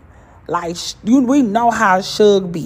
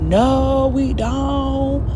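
heckling no; 8 semitones higher, much slower than usual. Retold or imitated. She heckles the rapper.

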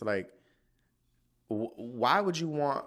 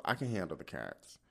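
ordinary talk, nothing marked

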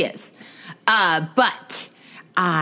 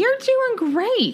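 slow, squeaky high encouragement; high cutesy cheer; much slower than usual. Pretend. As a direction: coo it slowly way up high. Teasing cheer, high put-on voice.

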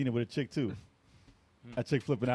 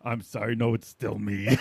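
deep pitch shifted; 8 semitones lower, slower than usual. Pretend. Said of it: "he used a voice changer".

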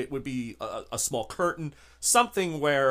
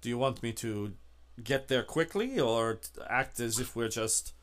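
low, soft, halting driver voice; obliging driver; 4 semitones lower, 7 dB quieter than usual. Acted. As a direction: deferential hired driver, quiet and low. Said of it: "Um, he says".

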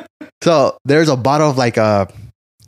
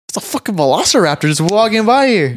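mock alarm; 8 semitones higher than usual. Retelling shocked sighting of a turkey.

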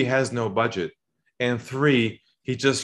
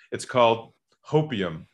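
usual speaking voice

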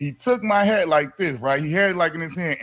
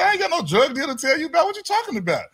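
high, fast retold protest; indignant disbelief; 10 semitones higher, faster than usual. Driver denying drugs to the officer, indignant. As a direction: argue back, pitch climbing at the end.

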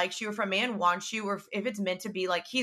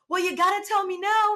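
much higher, urgent and pushy; frantic demand; 11 semitones higher, 5 dB louder than usual. Retold or imitated. Retelling pressing her friend, high frantic demand. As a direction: squeal it out, demanding she spill.

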